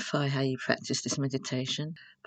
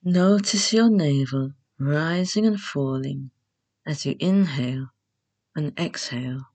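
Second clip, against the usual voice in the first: guided meditation; 4 dB louder than usual. Acted. Host leading beginners through Yoga Nidra, calm guided meditation. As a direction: guide them unhurriedly into rest, phrase by phrase.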